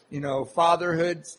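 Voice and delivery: normal talking voice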